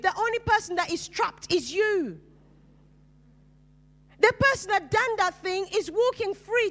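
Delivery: high, ringing preacher's voice; rising up to drive the point home to the congregation; preaching at full force. Acted. Preaching on forgiveness.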